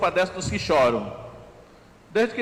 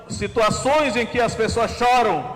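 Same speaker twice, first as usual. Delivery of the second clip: raised, emphatic preaching; 4 semitones higher than usual. Acted. Preacher proclaiming tears turn to joy, raised and emphatic. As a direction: press the promise home to the church.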